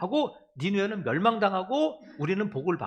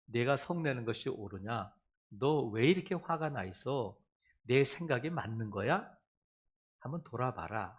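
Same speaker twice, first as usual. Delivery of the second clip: gentle questioning; 8 semitones lower, 4 dB quieter than usual. Acted. God softly asking the angry believer to look back. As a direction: lower and softer, tender asking.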